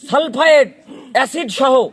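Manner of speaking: usual speaking voice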